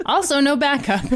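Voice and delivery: laughing through the words. Real, not acted. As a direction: chuckle while saying it. Laughing repeat.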